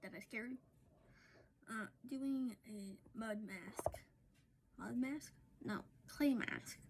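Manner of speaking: soft, low late-night murmur; hushed talk. Real, not acted. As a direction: talk quietly so as not to wake anyone. Quiet after-hours chat, mud mask.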